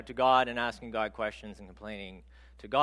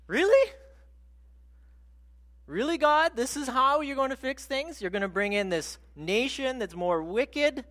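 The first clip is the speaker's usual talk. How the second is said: much higher, louder exasperated disbelief; incredulous prophet; 10 semitones higher, 7 dB louder than usual. Retold or imitated. Habakkuk protests to God's plan, exasperated and high. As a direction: let the disbelief crack upward in pitch. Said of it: "he says".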